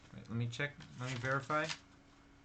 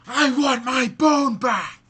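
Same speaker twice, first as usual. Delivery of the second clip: very loud, high, slow enraged ghost; furious ghost roar; 14 semitones higher, 21 dB louder, slower than usual. Acted. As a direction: roar it so the house shakes, drawn out. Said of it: "Now it sounded very angry, and it was so loud".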